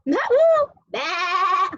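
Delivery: high-starting sheep bleat. Shown as a demonstration. Parent asking kids how a sheep sounds, sheep bleat. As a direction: demonstrate a sheep's baa. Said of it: "How does it sound like?".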